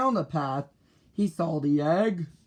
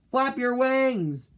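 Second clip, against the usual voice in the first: high, stretched-out yell; storybook yell; 8 semitones higher, 3 dB louder, slower than usual. A narrator's reading. Father bird urging Junior as he jumps, yelling. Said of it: "yelled Mister Bird".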